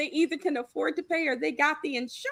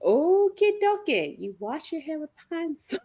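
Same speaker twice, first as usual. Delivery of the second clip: sarcastic okie dokie; 5 semitones higher, 4 dB louder than usual. Retold or imitated. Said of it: "And I'm thinking".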